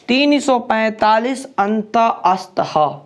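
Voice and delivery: slow, spaced-out memory formula; slow dictation. Shown as a demonstration. Teacher giving students a formula to memorise, slow dictation. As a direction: spell out the formula slowly, piece by piece.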